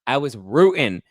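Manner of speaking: normal talking voice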